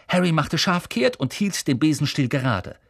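usual speaking voice

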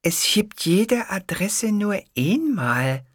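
very slow, firm explaining. Acted. Clerk explaining firmly and slowly to Hitler. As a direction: spell it out as if to a slow child. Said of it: "sagte sie fest, aber so langsam".

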